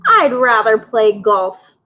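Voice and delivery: ordinary delivery, nothing marked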